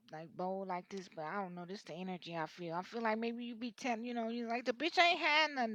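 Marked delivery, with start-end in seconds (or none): 4.7-5.7 higher and louder, scornful; mock blunt complaint. Reader voicing client complaining about broke woman, scornful. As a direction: toss it off with disdain.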